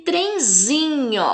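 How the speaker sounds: "trenzinho" slowly, ZI high and long; high, drawn-out ZI in "trenzinho"; tonic syllable stressed. Shown as a demonstration. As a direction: stretch ZI in "trenzinho". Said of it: "fala com mais intensidade".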